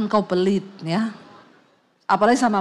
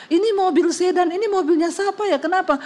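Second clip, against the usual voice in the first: acted alarmed call; 8 semitones higher than usual. Acted alarmed phone call about flood.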